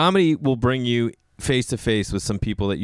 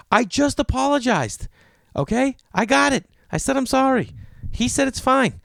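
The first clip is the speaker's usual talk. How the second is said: very high, strained mock outrage; exasperated protest; 14 semitones higher than usual. Golfer protesting to the scolding woman, mock exasperated. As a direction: argue back in short high bursts.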